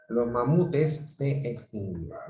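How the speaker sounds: normal talking voice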